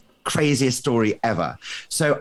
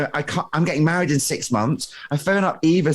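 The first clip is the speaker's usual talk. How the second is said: normal talking voice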